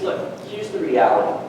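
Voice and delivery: low, softened fatherly explanation; a hint of a calm, reasoning father; lightly voiced father. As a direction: gently give a son perspective, voice dropping. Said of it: "The father gave him perspective".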